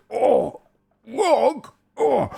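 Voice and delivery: very high ogre cries; losing ogre protesting. Acted. Losing ogre to his brother after the wrestle, high-pitched protest. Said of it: "punches the other one in the gut and goes".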